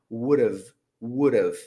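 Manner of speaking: "Would have" said as "wud-av", run together; reduced would have. Shown as a demonstration. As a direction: squash "would have" into one quick unit. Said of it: "você vai ouvir a frase dessa forma".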